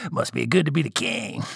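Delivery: soft snicker running into an aside; sniggering crony. Acted. Male spirits joke among themselves about the king, sniggering aside. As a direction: laugh slyly, then remark under it.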